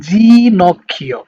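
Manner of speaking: জি নক্ষীয় with জি as a clear ee sound, slow; Italian pronunciation. Shown as a demonstration. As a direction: say জি নক্ষীয় slowly, the জি like "jee". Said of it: "উচ্চারণটা হচ্ছে ই টোনের দিকে যাবে".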